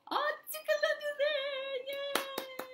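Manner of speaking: high excited squeal; giggly tickle attack. Acted. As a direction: squeal with delight. Tickle game climax.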